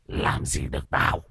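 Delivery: low, soft rasping growl; taunting possessed voice. A narrator's reading. Possessed man defies the master, raspy taunting growl. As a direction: sneer it hoarsely with a rising end. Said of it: "ré lên cười".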